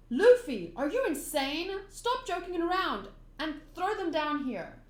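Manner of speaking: lightly acted telling-off; exasperated scolding. Friend scolds Luffy for keeping the treasure, mildly exasperated.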